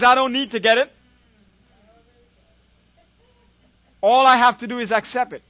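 high, loud preacher's proclamation; bold declaration. Retold or imitated. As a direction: declare it forcefully, voicing another's conviction. Retold bold declaration of faith.

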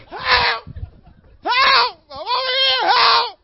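very high, loud desperate cries for help; frantic screaming. Retold or imitated. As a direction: scream for your life. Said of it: "No, you".

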